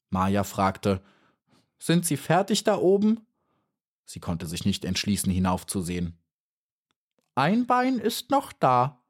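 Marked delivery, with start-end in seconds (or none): 1.8-3.2 high, timid bee voice; little bee Maya. A narrator's reading. Maya asks up. 7.3-9.0 high, slow offhand voice; dragonfly, much higher and slow; casual dragonfly. A narrator's reading. Dragonfly chewing.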